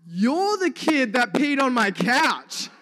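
slow, high, loud accusation; mock accusing yell. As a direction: imitate an outraged dad yelling across the shop. Acts out angry couch owner's accusation.

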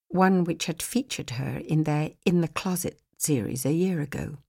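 plain reading voice

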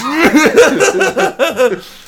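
high, loud derisive laugh; cop's scornful laugh at the question; mocking cop laugh. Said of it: "And the guy's like".

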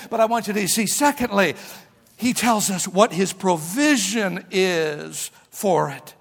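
ordinary delivery

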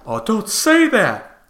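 mock shocked. To critics, mocking their shocked objection, high and slow. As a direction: clutch your pearls in mock horror. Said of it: "mocking a shocked critic".

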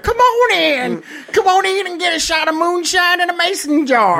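sing-song country hospitality; Southern host. Retold or imitated. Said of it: "then they're like".

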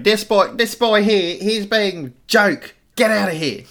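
high, drawn-out mock tirade; hammed-up complaint from an outraged pensioner; cane-waving old person. Pensioner waving a cane at a boy, hammed-up outrage. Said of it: "start waving around going".

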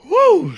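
high loud woo; mock woo. Told to throw that woo in, loud high woo. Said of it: "Throw that woo in".

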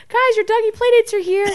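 high singsong owner voice; sweet pet-owner singsong; cooing owner. Retold or imitated. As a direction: announce the playdate like baby talk. Humans cooing at dogs.

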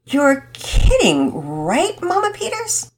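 stunned, half hoping it's a joke; mildly shocked child; aghast. Acted. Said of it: "Penelope was aghast".

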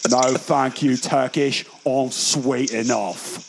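put-on Guy Ritchie gangster-film British accent; put-on British accent, slowing at the end; mock British accent. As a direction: quote it like a London film crook. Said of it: "the new Guy Ritchie movie".

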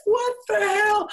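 high, loud disbelieving yelp; a comic high-pitched what the hell; shocked outburst. Retold or imitated. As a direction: squeal it in total disbelief. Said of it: "And I said".

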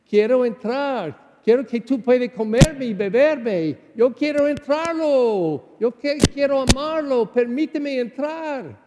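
Jesus at the door, begging to come in; voicing Jesus.

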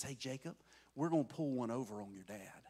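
low, hushed scheming mother; soft, low plotting voice; conspiratorial aside. Acted. Mama plotting behind dad's back.

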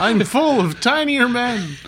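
high, slow toy robot voice; tinny action figure. Retold or imitated. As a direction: squeak it out like a proud toy.

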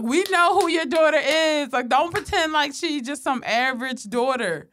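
mock retort. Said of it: "We like".

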